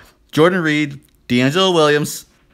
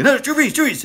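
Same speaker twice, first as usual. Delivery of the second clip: much higher, urgent cry of the name; panicked rush to fix the pick; frantic correction; 12 semitones higher than usual. Retold or imitated.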